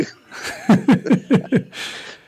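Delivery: breathless high laugh; high wheezing laugh. Real, not acted. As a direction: burst into a squeaky wheeze. Big laugh after story.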